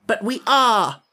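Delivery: stamping her foot insisting; a girl's indignant outburst; impatient cry. Acted. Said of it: "cried Ginger, stamping her foot impatiently".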